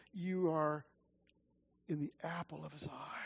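whispered-close comfort; tender hush. Real, not acted. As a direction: lean in and reassure quietly. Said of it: "intimate closing assurance".

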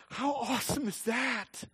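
wonder-struck; amazed exclamation. Real, not acted. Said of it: "marvelling exclamation".

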